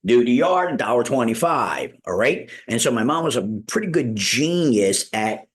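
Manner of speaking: ordinary delivery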